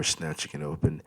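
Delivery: plain reading voice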